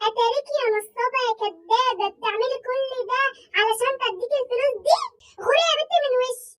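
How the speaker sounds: loud scold, chasing her off; angry scolding. Acted. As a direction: tell her off hard. Mom scolding a scammer daughter. (زعيق غضب)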